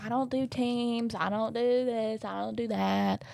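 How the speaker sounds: slow Southern drawl; Southern accent. Acted. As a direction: refuse with a drawn-out Southern twang. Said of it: "she also is Southern".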